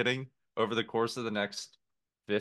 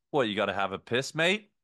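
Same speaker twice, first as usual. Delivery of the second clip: fake Australian accent; 4 dB louder than usual. Waiter ribbing a customer in a fake Australian accent. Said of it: "seemingly fake Australian accent".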